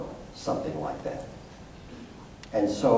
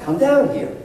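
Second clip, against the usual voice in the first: loud, much higher call with a rise on down; 7 dB louder than usual. Retold or imitated. Father calling his sons down, loud and high. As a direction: call up the stairs to the boys. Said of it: "He apparently said".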